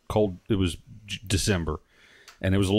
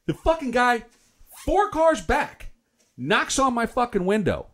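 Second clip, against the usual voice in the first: indignant; 9 semitones higher, slower than usual. Retold or imitated. Indignant retelling of rude guy.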